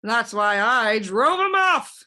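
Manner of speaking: loud, slow, fierce boast; loud fierce humbug cry. A narrator's reading. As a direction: brandish it like a threat. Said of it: "cried the humbug".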